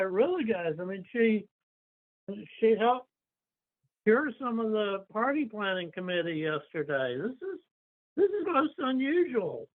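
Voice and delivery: high-pitched, baffled objection; Orion's indignant protest. Acted. Party member challenges soldiers over their prisoner, indignant and high-pitched. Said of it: "So Orion will say".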